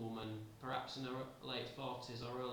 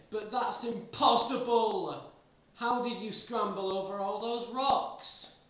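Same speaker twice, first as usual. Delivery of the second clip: high, loud, slow disbelief; shrieking astonished accusation; incredulous shout; 8 semitones higher, 11 dB louder than usual. Acted. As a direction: yell it as if you can't believe your eyes.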